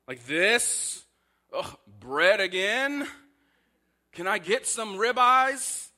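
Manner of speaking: hammed-up complaining Israelite asking for ribeyes; whiny grumbler. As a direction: whine like a spoiled Israelite. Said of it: "It's grumbling".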